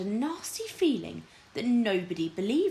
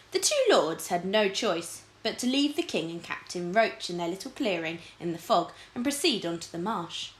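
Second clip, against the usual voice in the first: ordinary talk, nothing marked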